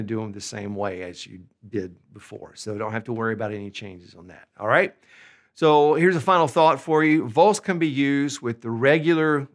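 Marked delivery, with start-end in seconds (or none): none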